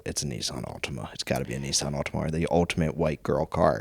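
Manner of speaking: low, soft mutter to himself; a quick quiet aside under the breath. Retold or imitated. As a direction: say it half to yourself, under your breath. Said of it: "I mutter under my breath".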